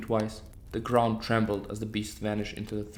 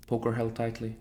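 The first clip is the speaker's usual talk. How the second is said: usual speaking voice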